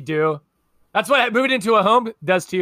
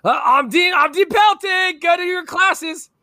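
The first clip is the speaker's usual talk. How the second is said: high, slow, loud imitation of the dean; Dean Pelton quote; 8 semitones higher, 4 dB louder, slower than usual. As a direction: announce yourself grandly like the dean. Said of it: "one of my favorite quotes of his is".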